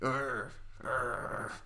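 disgusted groan then a voiceless whisper; soft revolted whisper. Acted. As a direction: groan and hiss the name in disgust.

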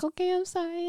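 put-on a light high child's voice, soft; mock little-kid apology. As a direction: imitate a sheepish small kid. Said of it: "And then be like".

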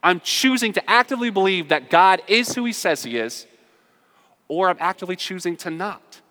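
impassioned preaching. Real, not acted. Preacher declaring choice to believe to congregation, impassioned. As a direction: declare it loudly with defiant resolve.

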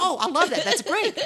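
high, loud gushing imitation; imitated delight. Imitating coworkers charmed by Southern slang, delighted. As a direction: gush it high, half laughing.

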